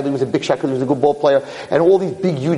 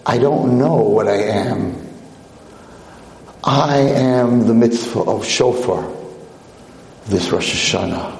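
calm mysterious angel; 4 semitones lower, slower than usual. Acted. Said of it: "he says".

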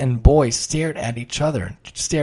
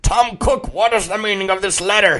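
higher, gruff pirate demanding an answer; angry pirate uncle; 7 semitones higher, 3 dB louder than usual. Acted. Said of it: "he growled".

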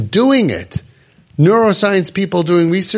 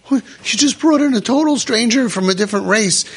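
high, incredulous parent reacting; shocked parent; 7 semitones higher than usual. Parent aghast at kid bringing a stranger home.

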